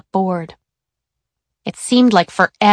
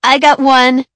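higher, louder eager call-out; shouting out; 5 semitones higher, 7 dB louder than usual. A narrator's reading. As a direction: blurt it out from the front row. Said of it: "shouted out from his seat".

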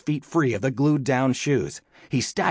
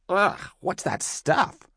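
slow, higher-pitched revulsion; disgusted cursing; 8 semitones higher, slower than usual. A narrator's reading. Lola finding sticky stuff in the dark, cursing. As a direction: drag it out in disgust. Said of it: "Tom heard her cursing".

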